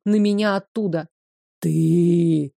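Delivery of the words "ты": low hissing demon voice; demonic hiss. Acted. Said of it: "прошипел демон".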